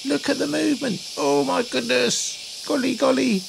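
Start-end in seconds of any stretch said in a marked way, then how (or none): none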